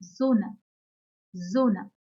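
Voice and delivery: "Sonne" with S voiced like z; German example word. Shown as a demonstration. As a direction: say "Sonne" as ZON-nuh, carefully. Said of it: "For example".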